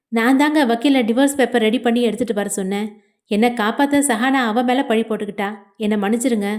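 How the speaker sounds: usual speaking voice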